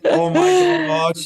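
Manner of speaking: high, drawn-out gasp of delight; amazed exclamation. Real, not acted. Host thrilled by the guest's message, drawn-out high exclamation. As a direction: let it burst out, stretched and high.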